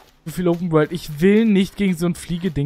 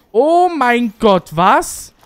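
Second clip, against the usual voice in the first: loud, slow drawn-out oh mein Gott then sharp what; loud slowed stunned cry; shocked exclamation; 5 semitones higher, 7 dB louder, much slower than usual. Real, not acted. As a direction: blurt it out, stunned by the screen. Shocked by game event.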